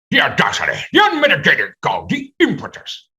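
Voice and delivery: theatrical, rising into high pitched indignation; mock outrage. Hamming up outrage for the interviewer, rising theatrical indignation. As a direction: declaim it like an affronted aristocrat.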